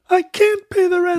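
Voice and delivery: high, wailing damsel in distress; distressed widow. Acted. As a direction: plead like a melodrama widow. Widow who can't pay rent.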